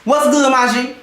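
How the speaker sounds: low, imitated homeboy hello; mock dude-to-dude tone; masculine bro greeting. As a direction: greet them like one of the guys, mockingly. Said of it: "you be like".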